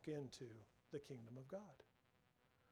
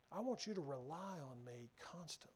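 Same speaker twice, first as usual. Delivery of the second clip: unvoiced whispered plea, softer; hushed whisper; 7 dB quieter than usual. God tenderly asking his people for trust, whispered. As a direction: breathe it as a whisper, intimate and close. God's plea, intimate climax.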